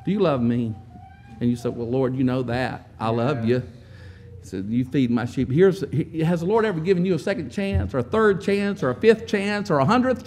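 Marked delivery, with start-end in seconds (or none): 0.1-5.5 tender low exchange. Acted. Quiet tender dialogue with Lord.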